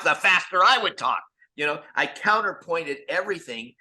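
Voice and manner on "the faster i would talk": higher and louder, quick and eager; fast talk. Shown as a demonstration. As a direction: rattle it off with energy. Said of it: "it was so funny because".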